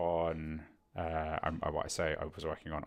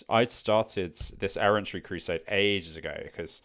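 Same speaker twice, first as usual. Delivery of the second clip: normal talking voice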